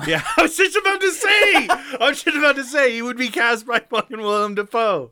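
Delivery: high, loud, laughing agreement; real laughing excitement; excited. Real, not acted. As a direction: jump in with delight. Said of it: "Mm-hmm".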